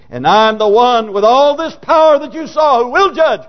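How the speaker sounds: high, forceful declaration of power; impassioned preaching. Acted. Preacher voicing Christ as judge, forceful preaching. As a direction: proclaim it as the all-powerful judge.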